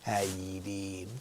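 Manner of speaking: low, quiet put-on drawn greeting; funny greeting impression. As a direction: imitate the maniac's low goofy greeting. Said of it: "He'd walk up, he'd go".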